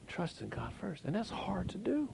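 high soft whisper; hushed admission. Real, not acted. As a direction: let it slip out as a whisper. Hushed confession.

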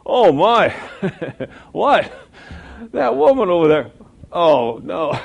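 high loud laughing shock; shocked passenger. Retold or imitated. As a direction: gasp at the woman outside. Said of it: "I hear one of my passengers say".

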